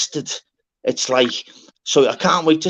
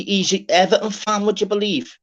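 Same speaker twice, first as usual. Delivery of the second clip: ordinary talk, nothing marked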